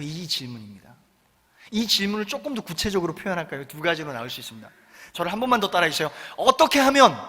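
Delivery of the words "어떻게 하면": earnest plea. Shown as a demonstration. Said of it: "이게 간절해야 돼요".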